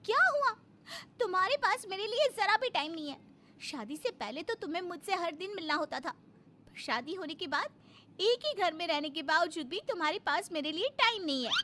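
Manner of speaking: high-pitched, indignant outburst easing into complaint; upset wife. Acted. Neglected wife to husband who has no time, upset. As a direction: throw the grievance at your husband. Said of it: "प्रीति एक बार फिर से नाराज".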